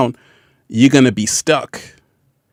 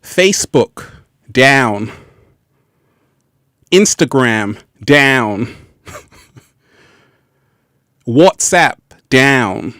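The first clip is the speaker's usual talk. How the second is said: slow gloating list; slower than usual. Acted. Listing outages gleefully.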